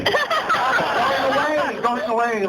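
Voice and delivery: drawn out very slow and high, laughing; mock lament as the deal slips away; fading away. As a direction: stretch it out as the chance slips off. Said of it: "I could see it all going away".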